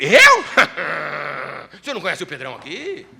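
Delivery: boastful Peter. Acted. Peter brushing off Jesus' warning, boastful and laughing. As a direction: scoff as if nobody could doubt him.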